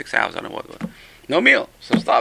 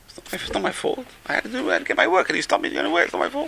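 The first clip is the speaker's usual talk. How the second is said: imitated frantic protest; 9 semitones higher, 4 dB quieter, faster than usual. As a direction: imitate a man squealing excuses to the judge. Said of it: "Why are you screaming?".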